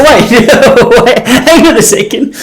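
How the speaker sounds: high, laughing disbelief; retelling catching a friend contradicting himself, laughing. Retold or imitated. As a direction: laugh through the words, stopping him.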